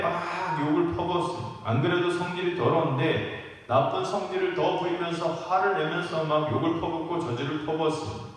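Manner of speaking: usual speaking voice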